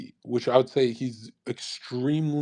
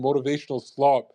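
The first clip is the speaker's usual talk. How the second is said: normal talking voice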